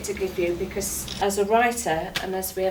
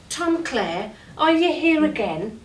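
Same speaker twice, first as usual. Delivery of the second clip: higher, louder teasing call as the players; mock exasperated greeting; 6 semitones higher, 4 dB louder than usual. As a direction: call out to the lad in fond mock complaint. Players' fond teasing greeting, quoted.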